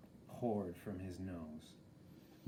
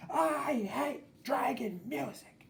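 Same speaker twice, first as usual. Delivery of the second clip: mock shout; 4 dB louder, slower than usual. A narrator's reading. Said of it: "the princess shouted".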